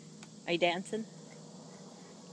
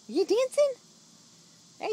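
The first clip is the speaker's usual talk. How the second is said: high, loud delighted question; excited squeal; 13 semitones higher, 5 dB louder than usual. Real, not acted. Parent thrilled watching baby dance, excited squeal. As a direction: squeal with joy at the dancing.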